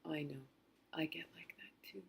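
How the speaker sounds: soft unvoiced comforting whisper. Toddler comforting his crying mom in a whisper. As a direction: whisper it gently like a small child. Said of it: "and he goes".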